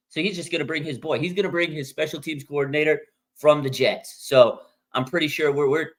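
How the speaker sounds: ordinary talk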